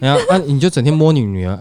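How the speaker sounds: normal talking voice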